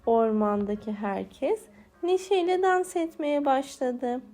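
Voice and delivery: usual speaking voice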